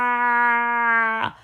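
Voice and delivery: long, loud, high monster roar. Acted. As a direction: roar to scare everyone away. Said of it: "the monster comes and goes".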